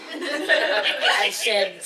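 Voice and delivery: laughing through the words. Real, not acted. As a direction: chuckle as you say it. Laughing at the memory.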